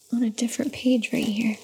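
hushed unvoiced whisper. Real, not acted. Mother pointing at the book, whispering. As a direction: murmur it under your breath.

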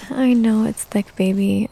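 ordinary delivery

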